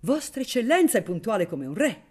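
high, loud, gushing exclamation; exclaimed greeting. A narrator's reading. Magistrate greeting the baron at his door, exclaiming. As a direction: hail a grand guest effusively. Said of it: "esclamò il grazioso cavaliere".

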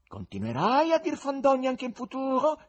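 high, loud threatening tenor; menacing question. A narrator's reading.

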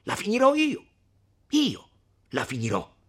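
high, loud angry outburst; loud, defiant interruption; menacing resolve. A narrator's reading. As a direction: burst out with dead-set menace. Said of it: "con una voce, con un viso da non lasciar dubbio".

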